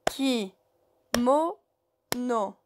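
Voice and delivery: "Ki. Mo. No." with a clear pause between syllables; syllabes séparées. Shown as a demonstration. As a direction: count out "Ki. Mo. No." one syllable at a time. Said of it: "C'est trois syllabes". (« Ki. Mo. No. » en syllabes bien détachées)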